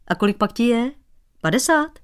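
high, teasing questions climbing up; teasing prying. Acted. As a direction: needle him about his age. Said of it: "vyzvídal Dratvička". (škádlivě, vysoko)

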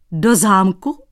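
loud surprised squeal. Acted. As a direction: yelp in disbelief. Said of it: "Vyjekl Dratvička". (vyjeknutí)

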